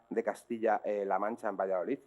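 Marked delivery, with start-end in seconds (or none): none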